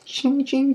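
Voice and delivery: high metallic ching sounds; sword clash noises. Retold or imitated. Mimics lightsaber sword clash.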